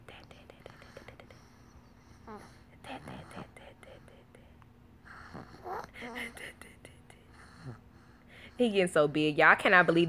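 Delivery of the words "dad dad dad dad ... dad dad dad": put-on repeated, barely audible baby whisper; imitated whisper. Mom shows how her baby says it, whispering. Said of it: "He likes to whisper".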